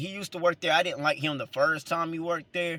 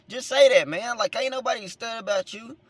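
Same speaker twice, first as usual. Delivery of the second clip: higher-pitched dismissive retort after a laugh; mock exasperation; 8 semitones higher than usual. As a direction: brush him off, voice lifted. Acting out his dismissal of coworker.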